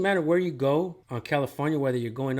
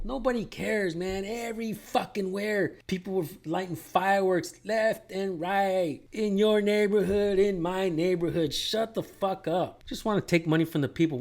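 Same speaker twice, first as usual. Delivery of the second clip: worked-up, high-pitched ranting; exasperated rant; 6 semitones higher, 3 dB louder than usual. Real, not acted. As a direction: stretch the words out, pitched up in exasperation. Heated rant at police over rules.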